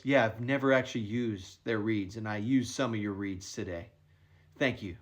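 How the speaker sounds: a hint of a low, quiet deadpan golfer. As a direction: lightly mimic a straight-faced golfer, low and quiet. Golfer's odd deadpan remark retold.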